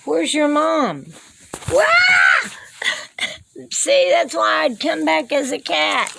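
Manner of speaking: high sing-song pet talk; talking to kittens. Acted. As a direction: coo and scold the kittens like babies. Talks to the kittens.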